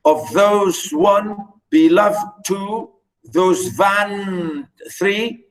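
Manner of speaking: slow, segmented reading, foot by foot; scanning verse feet. Shown as a demonstration. Teacher counting iambic feet for students, slow scanning. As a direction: stretch each foot and pause after it.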